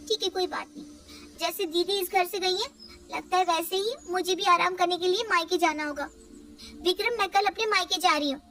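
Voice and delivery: a worn-out wife begging her husband for help; complaining young wife. Acted.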